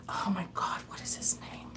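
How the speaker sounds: hushed whisper to herself; whispered aside. Real, not acted. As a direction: whisper it under your breath. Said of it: "whispered aside".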